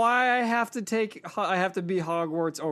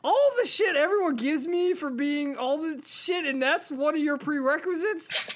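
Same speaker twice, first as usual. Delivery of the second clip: high-pitched, incredulous protest; outraged at the hypocrisy, pitched high throughout; indignant disbelief; 9 semitones higher than usual. Real, not acted. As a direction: protest the double standard, voice shooting up. Mock outrage at host's prerequisite.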